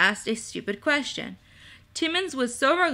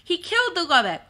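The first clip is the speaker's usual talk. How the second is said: raised, high-pitched insistent telling; shouting over noise; 6 semitones higher, 4 dB louder than usual. A narrator's reading. Peter tells clamoring kobolds the news, shouting over noise. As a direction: call it out over a crowd, fairly high. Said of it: "Peter shouted through the noise".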